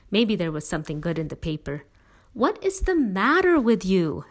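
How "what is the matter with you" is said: exasperated demand, higher and louder. A narrator's reading. Sister demanding why her brother isn't thrilled. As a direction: push him for an answer. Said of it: "Allie demanded".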